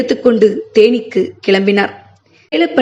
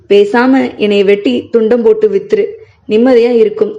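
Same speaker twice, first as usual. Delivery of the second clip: ordinary delivery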